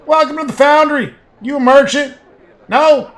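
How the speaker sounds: high, very loud shouted greeting; shouting. A narrator's reading. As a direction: bellow it as if deafened by foundry noise. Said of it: "He shouts".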